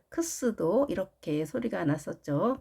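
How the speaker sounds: normal talking voice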